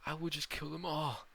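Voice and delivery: heated, forceful outburst; angry yell. Acted. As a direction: yell a defiant vow. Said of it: "he angrily yells".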